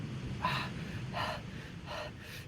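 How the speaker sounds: acted distressed breaths without voice; heavy quick breathing. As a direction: breathe hard, on the edge of panic. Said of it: "breathing heavily, quickly".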